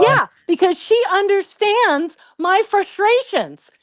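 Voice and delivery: put-on high, indignant teen retort; imitated arguing son. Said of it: "And now they start fighting".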